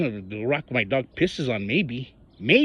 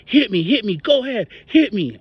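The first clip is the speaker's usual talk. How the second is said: taunting quote; 7 semitones higher, 5 dB louder than usual. Retold or imitated. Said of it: "started telling me things like".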